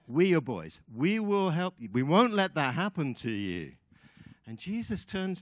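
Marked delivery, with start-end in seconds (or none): 0.1-3.7 loud, rallying pledge of loyalty; vehement protest. Acted. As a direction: insist forcefully as a loyal friend. Peter protests to Jesus.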